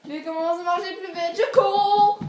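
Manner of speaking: hammed-up escalating alarm; mounting chase panic. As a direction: build the chase to a long, high wail.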